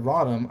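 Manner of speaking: Rotom said as RAH-dum; others' pronunciation. Shown as a demonstration. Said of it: "a lot of people pronounce it".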